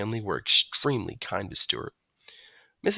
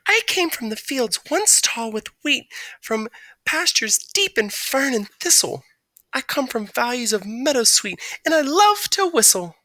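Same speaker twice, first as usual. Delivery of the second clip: lilting bird rhyme; 14 semitones higher, 4 dB louder than usual. A narrator's reading. Bird recites verse.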